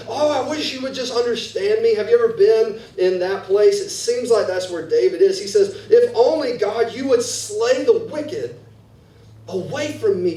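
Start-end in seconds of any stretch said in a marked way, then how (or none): 0.1-1.9 mock frustrated outcry, higher; exasperated outburst. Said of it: "slam your hand on the table and say". 5.9-10.3 impassioned plea. Acted. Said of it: "the voice of David seems to change".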